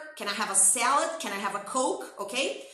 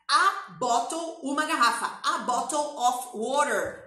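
slow model phrase; slower than usual. Shown as a demonstration. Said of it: "teacher models slowly".

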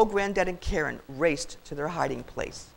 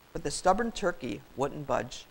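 ordinary delivery, nothing marked

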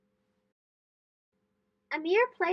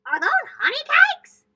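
much higher and louder squeal; excited; 10 semitones higher, 9 dB louder than usual. Acted. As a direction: squeal with greedy delight. Kat spots treats.

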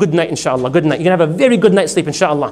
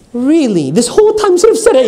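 incredulous; 4 semitones higher than usual. Acted. Passenger reacting to the lady's praise, incredulous and high. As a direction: voice leaping up in disbelief.

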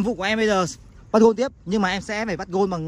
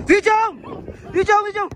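excited calling out; 11 semitones higher than usual. Real, not acted. Calling the player hit.

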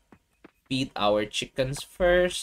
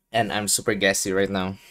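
ordinary talk

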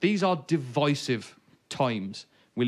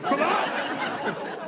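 high laughing cheer; a mate's thrilled whoop; excited friend; 10 semitones higher than usual. Retold or imitated. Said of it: "my friend went".

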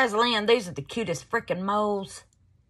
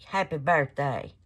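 ordinary talk

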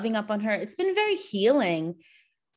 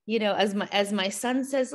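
normal talking voice

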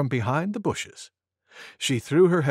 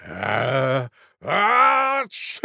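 big mock sneeze; much slower than usual. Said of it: "taking a deep breath, she went".

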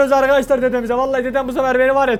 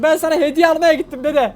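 ordinary delivery

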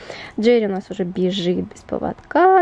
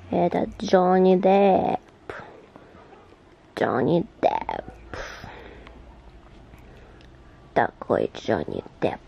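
talking to a dog; 4 semitones lower, 4 dB quieter than usual. Real, not acted. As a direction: drop the voice low and coo. Slow low cooing at puppy.

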